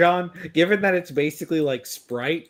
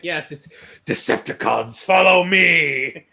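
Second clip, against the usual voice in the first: Megatron catchphrase; 6 dB louder than usual. Retold or imitated. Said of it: "Be like".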